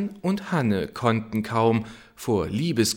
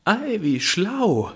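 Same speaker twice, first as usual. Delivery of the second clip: higher, slower sly mock; a touch of mockery; 10 semitones higher, 4 dB louder than usual. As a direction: sneer it slowly. Steffen mocks inwardly. (spöttisch, langsam und höher)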